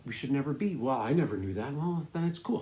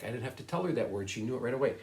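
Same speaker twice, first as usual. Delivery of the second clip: ordinary delivery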